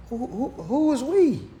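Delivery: high, stammered incredulous question; mock baffled questioner. Streamer questioning imagined voter, high baffled stammer. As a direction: stutter it out high, playing baffled.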